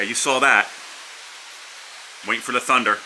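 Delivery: usual speaking voice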